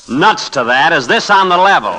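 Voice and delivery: high, loud insistent question; excited demand. Acted. Reporter pressing his editor about the promotion, excitedly. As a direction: press him, barely believing.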